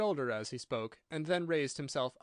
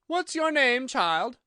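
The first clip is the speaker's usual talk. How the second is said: loud, high demand; shouted question from a severe queen; imperious queen; 10 semitones higher, 9 dB louder, slower than usual. A narrator's reading. Queen questioning the child Alice, loud imperious demand.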